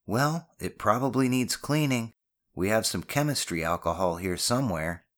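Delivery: ordinary delivery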